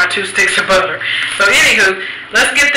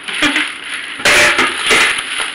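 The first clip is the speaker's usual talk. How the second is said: giggle; 6 semitones higher than usual. Real, not acted. Laughs at own large amount.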